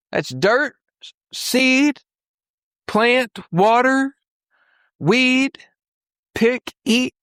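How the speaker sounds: high, spaced-out words, one step at a time; exasperated listing. Retold or imitated. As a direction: spell out the obvious in a high voice, pausing between steps. Spelling out the obvious to students.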